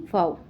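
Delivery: फाओ said as "fao", with no x sound; correct pronunciation. Shown as a demonstration. Teacher giving the class the right reading फाओ, said "fao". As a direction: say faux as "fao". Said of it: "फॉक्स नहीं".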